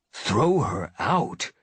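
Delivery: slow scornful echo; spat. A narrator's reading. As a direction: spit the words back in contempt. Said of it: "spat Darkstripe".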